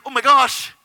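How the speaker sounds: high, loud exclamation; so excited. Retold or imitated. Reliving the moment he got it, so excited. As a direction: cry out, thrilled. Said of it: "I was just so excited".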